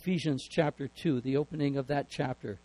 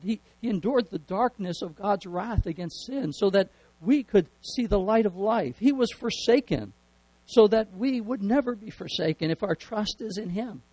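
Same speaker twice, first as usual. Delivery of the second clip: rising preaching climax; 4 semitones higher than usual. Real, not acted. Preacher to congregation on the cross, building fervour. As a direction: lift the congregation toward the climax.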